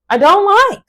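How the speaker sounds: "I don't like" jumped up high and squeaked; squealed repeat. Acted. Repeats the line, then squeals it.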